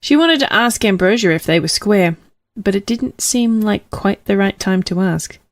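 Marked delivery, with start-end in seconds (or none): none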